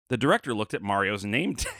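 ordinary talk

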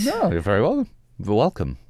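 speaking with a swollen tongue; words thick from a swollen tongue. Real, not acted. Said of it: "My tongue is swollen".

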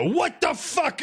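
high-pitched mock double take; startled orc. Orc suddenly spotting the player, startled. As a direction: blurt it, comically taken aback. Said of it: "they see you and go".

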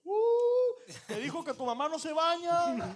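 high, drawn-out "ooh" then a slow taunt; loud, teasing singsong stir; mock goading. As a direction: egg on a fight like a heckler. Playground-style goading between two drivers.

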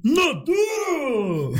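high cheering shout; excited shout. Real, not acted. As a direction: yell it out in delight. Cheering the promised song.

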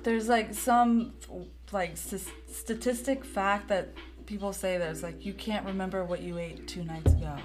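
ordinary delivery

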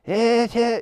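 high, drawn-out bad singer impression. Shown as a demonstration. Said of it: "I think mine's probably terrible".

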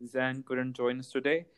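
normal talking voice

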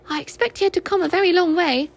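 lightly squeaky little piglet; small Piglet voice. A narrator's reading. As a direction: pitch it up like a tiny pig. Piglet speaking in story.

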